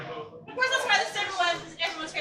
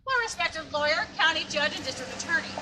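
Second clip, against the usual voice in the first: tour guide narration; 3 semitones higher than usual. Acted. Ghost tour guide presenting.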